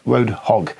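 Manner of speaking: ordinary talk, nothing marked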